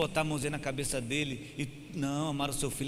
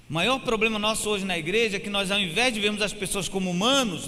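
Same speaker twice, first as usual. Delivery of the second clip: fiery preaching; 5 semitones higher, 6 dB louder than usual. Acted. Pastor warning his congregation, fiery preaching. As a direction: preach it out, higher and louder.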